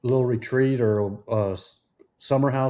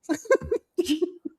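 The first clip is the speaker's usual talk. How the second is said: laugh; 4 dB quieter than usual. Real, not acted. Laughing at own singing joke.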